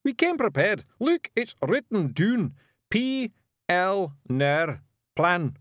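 high, loud wee Scots warrior voice; Scottish Feegle Rob. A narrator's reading. Rob Anybody brags to Miss Level, high Scots Feegle. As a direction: play a cocky little Feegle, high and brash.

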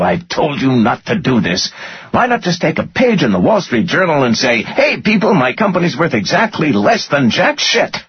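very high, sarcastic angry rant; furious tirade. A narrator's reading. Finance guy insulting his boss's judgement, furious rant. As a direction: rant in outraged sarcasm, voice climbing.

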